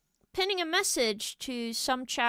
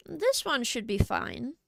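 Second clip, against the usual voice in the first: usual speaking voice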